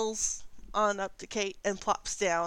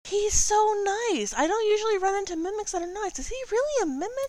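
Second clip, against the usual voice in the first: cooing delight; 12 semitones higher, 5 dB louder than usual. Acted. NPC cooing over mimic.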